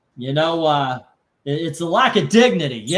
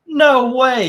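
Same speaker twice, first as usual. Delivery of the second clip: higher and louder, mock aghast; shocked disbelief; 9 semitones higher, 8 dB louder than usual. Jail cellmate hears the secret, hammed-up shocked blurt.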